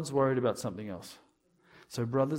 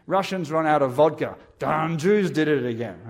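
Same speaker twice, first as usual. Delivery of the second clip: loud mock blame; 3 semitones higher, 9 dB louder than usual.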